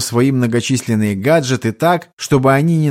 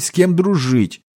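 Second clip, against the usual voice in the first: usual speaking voice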